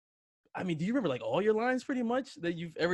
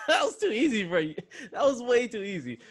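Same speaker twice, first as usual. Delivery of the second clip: laughing; 9 semitones higher than usual. Real, not acted. Laughing at easy answer.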